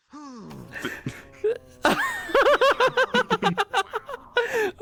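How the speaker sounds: helpless high-pitched laughter; high laughing fit. Real, not acted. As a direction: break down laughing. Bursts out laughing after kill.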